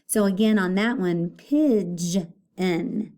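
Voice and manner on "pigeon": "pigeon" in two parts, pidge... en; syllable split. Shown as a demonstration. Teacher showing the split to pupils, "pigeon" broken in two. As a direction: hold pidge, pause, then en in "pigeon". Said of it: "put the syllable split".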